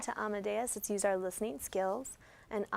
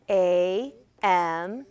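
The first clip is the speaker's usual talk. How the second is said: loud, drawn-out letters; emphatic letter-by-letter spelling; spelling aloud together; 8 dB louder than usual. Shown as a demonstration. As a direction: call out each letter for the class, stretched and separate. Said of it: "Let's spell it together".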